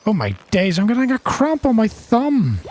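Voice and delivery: high-pitched wail under strain; real, rising panic in the game; strained complaint. Real, not acted. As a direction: squeal it while struggling. Straining to reel in the fish.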